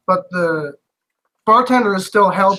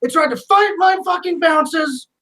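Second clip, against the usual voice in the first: much higher, shouting whiny complaint; a rattled barman yelling his grievance; bartender complaining; 9 semitones higher than usual. Acted. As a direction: bartender shouting to the guard about the fight. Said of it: "He's like".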